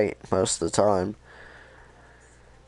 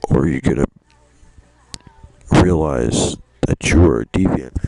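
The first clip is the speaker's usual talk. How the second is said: villain temptation; 3 semitones lower, 7 dB louder than usual. Retold or imitated. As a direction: lean in and tempt him. Kamsky tempting Connor.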